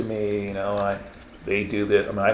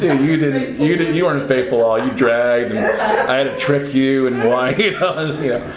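louder, laughing, amused rebuke; God teasing; 2 semitones higher, 3 dB louder than usual. Retold or imitated. God laughing at him, lightly voiced amused rebuke. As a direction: tease him like a friend who saw through it. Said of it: "He's like".

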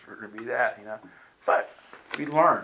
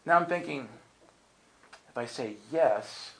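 ordinary talk

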